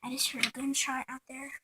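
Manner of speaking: hushed, frightened whisper; scared whisper. Real, not acted. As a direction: whisper so no one outside hears. Scared whisper after gunshot.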